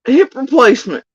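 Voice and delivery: mock outrage. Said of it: "I'm like".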